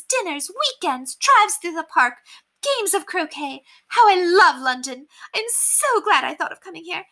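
high delighted aristocrat, lists slowed; overjoyed. Acted. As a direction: savour each invitation, thrilled. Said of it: "Overcome with joy".

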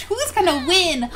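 ordinary talk

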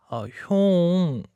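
high, drawn-out, coaxing call; wheedling. Acted. Younger dog boy begging his new hyung, wheedling. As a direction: stretch it out in a whiny plea.

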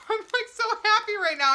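high-pitched, overwhelmed happiness; happy, near tears. Real, not acted. Reviewer overcome by buffalo wing popcorn, happy near tears. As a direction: let the voice rise as if about to cry for joy. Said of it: "I'm trying not to cry".

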